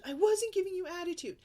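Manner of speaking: high, loud indignant denial; defensive kid denial. Retold or imitated. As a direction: whine it like a denying child.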